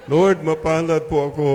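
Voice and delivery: convincing declaration. Shown as a demonstration. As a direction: proclaim it like you mean it. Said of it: "parang hindi naman convincing".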